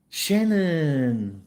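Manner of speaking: ordinary talk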